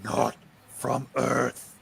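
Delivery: slow, ominous creature voice; a looming presence revealing itself; otherworldly thing. Acted. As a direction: let the monster speak each word slowly. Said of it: "the thing says".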